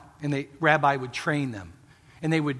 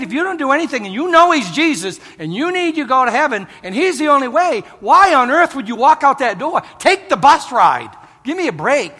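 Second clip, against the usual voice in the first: loud, higher urgent preaching; preaching at full force; 7 semitones higher, 6 dB louder than usual. Acted. Preacher urging listeners not to leave, preaching loudly. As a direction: plead with the crowd to decide now. Altar-call exhortation.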